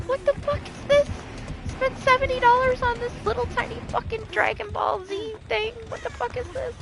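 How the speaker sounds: high-pitched mock outrage; retold disbelief. Mom unboxing a tiny seventy-dollar figure, mock disbelief. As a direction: squeak it out, baffled by a rip-off.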